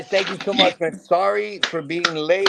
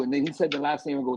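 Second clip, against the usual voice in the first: ordinary talk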